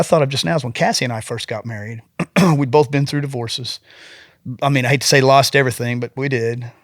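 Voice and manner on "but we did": ordinary delivery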